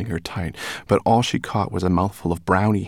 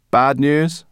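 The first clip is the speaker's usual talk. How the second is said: higher, loud and scornful; scoffing brownie; 8 semitones higher, 8 dB louder than usual. A narrator's reading.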